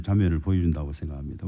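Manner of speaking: ordinary talk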